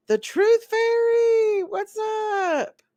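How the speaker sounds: high, loud delighted hello; excited greeting. Real, not acted. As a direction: call out the name with glee.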